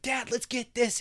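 put-on high, loud eager kid; child voice. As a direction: ask Dad like an excited child. Child asking dad.

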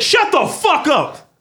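high, drawn-out yell of outrage; retold yell. Retelling his reply to the sociology teacher, outraged yell. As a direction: yell it slowly, hammering out the words. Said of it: "I yelled it".

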